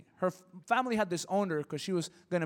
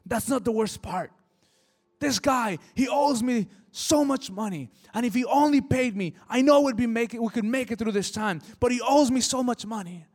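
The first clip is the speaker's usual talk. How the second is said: raised, heated voice, louder and higher; retelling a man's bitter anger over a debt; angry rant; 5 semitones higher, 6 dB louder than usual. Retold or imitated. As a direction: vent the grievance hotly. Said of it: "his face just turns angry".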